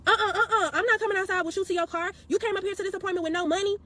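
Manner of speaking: hammed-up stylist refusing to follow her outside; mock stylist refusal. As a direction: mimic the stylist shutting her down.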